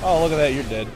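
usual speaking voice

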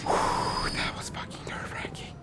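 blown-out sigh into a whisper; very soft, voiceless relief; relieved whisper. Real, not acted. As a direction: whisper it, all tension let go. Relief after tense fight.

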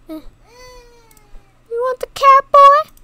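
high, loud squeaky teasing voice; coaxing the baby with the cat toy; teasing squeal. Acted. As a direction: tease the baby in a squeaky pitch.

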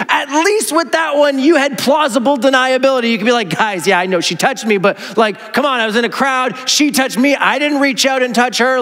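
mock-scold Jesus like a weary buddy; exasperated teasing. Speaker teasing Jesus about his rule-breaking, playfully exasperated. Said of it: "I just have to giggle at this point because I'm like".

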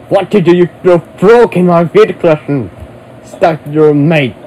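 loud, fast, disbelieving yell; outraged shout. Acted. Finding his video collection broken, shouting in outrage.

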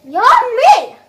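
loud high squeal of delight; delighted yummy. Pretend. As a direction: shout it with joy.

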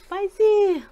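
whispered gasp, mouth burning; breathless from spice. Real, not acted. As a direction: breathe it out through the heat. Burning from spicy food.

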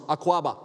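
Akwaba as ah-KWAH-bah, stress on the middle; Twi greeting. Shown as a demonstration. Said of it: "I'll say Akwaba".

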